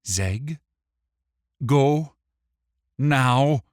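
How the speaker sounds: loud, high, word-by-word order; tight command. A narrator's reading. Wick orders the imp away, tight-voiced. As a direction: bite out each word with long gaps. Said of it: "commanded in a tight voice".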